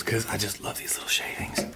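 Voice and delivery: hushed, breathy whisper of delight. Real, not acted. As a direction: whisper it lovingly to the wood. Soft aside about shavings.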